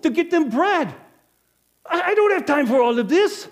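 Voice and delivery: high, loud, pressured outburst; building to a shout of frustration; overwhelmed rant. Acted. Innkeeper at the well rants loudly that everyone depends on him.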